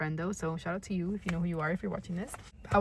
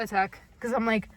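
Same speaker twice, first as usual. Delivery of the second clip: ordinary delivery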